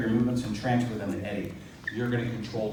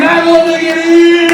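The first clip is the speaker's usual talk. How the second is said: big excited shout, high and loud; 18 dB louder than usual. Real, not acted. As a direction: yell her name with delight. Host greets guest excitedly.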